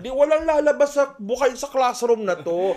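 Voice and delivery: a hint of a fed-up teacher threatening students; lightly acted irritated teacher. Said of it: "kung binadrip nyo ako".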